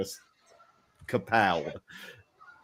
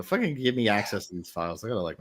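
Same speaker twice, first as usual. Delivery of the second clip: ordinary delivery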